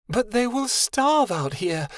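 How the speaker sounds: high, slow, appalled plea; a touch of horror, begging for the pups; horrified cry. Acted. Vera pleads with Winter for the pups, horrified. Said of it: "Vera cried, horrified".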